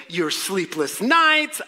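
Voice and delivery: usual speaking voice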